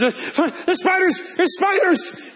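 high-pitched imitation of a panicked night cry; mock panicked scream. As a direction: squeal about spiders in a startled half-joking way. Wife screaming at night, retold.

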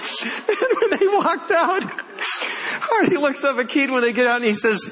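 high voice, still recovering from laughter; amused, laughing narration. Real, not acted. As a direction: tell it through lingering giggles.

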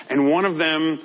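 normal talking voice

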